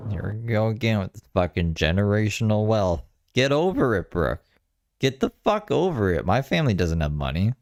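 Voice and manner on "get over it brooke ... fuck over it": exasperated. Real, not acted. Friend scolds her about wealth.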